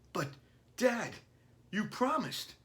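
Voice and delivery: put-on high child's voice, pleading; young son protesting to his dad, high and pleading; little boy. A narrator's reading. Billy voiced by reader.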